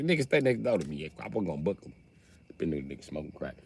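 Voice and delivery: soft, low offhand mumble; muttered excuse. Retold or imitated. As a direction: brush it off under your breath. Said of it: "He be like".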